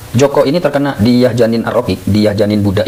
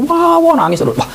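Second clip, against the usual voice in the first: alarmed exclamation. Retold or imitated. Alarm at baby crying nonstop.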